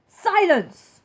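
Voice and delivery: loud, high bark of a command; king silencing Mac; furious king. Acted. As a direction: slam the word down. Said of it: "the king of the turtles back".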